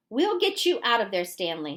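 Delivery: plain reading voice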